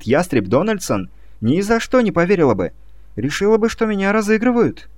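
high astonished disbelief; surprised. Acted. Julia-like disbelief.

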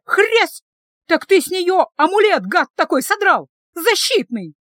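high, loud accusing shout; shouted accusation. Acted. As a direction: hurl the charge at him. Sister shouting accusations in quarrel.

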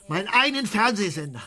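normal talking voice

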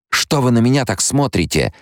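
put-on a girl who doesn't understand the stares; sweet young girl. Alia asking why everyone stares, in a young girl's voice. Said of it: "голос той сладостной юной Алии".

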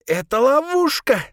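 high, loud hoarse cry. A narrator's reading. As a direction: rasp it out desperately. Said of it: "прохрипела Сабрина".

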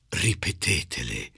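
ordinary talk

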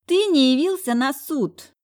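high shrill-free whiny wife voice; capricious ex-wife. A narrator's reading. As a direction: play the spoiled ex-wife. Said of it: "капризный голосок".

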